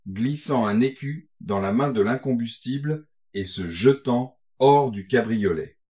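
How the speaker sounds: ordinary delivery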